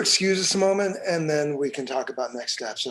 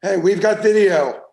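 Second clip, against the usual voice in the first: raised voice to get attention; calling out; 2 semitones higher, 7 dB louder than usual. Acted. Detective calling his partner over to the video, raised voice. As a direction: call it across the room, louder.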